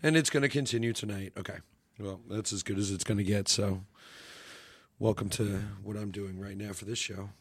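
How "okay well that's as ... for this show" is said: hoarse, barely-there voice; raspy whisper. Real, not acted. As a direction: rasp it out with no voice left. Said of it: "I have no voice".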